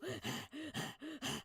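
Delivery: sharp inhaled pant, mimicking the dog; imitated dog gasp. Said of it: "He'd go".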